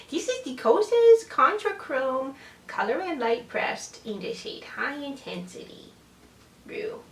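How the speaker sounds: slow cat voice. Real, not acted. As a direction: keep talking in cat voice. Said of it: "I'm using my cat voice still".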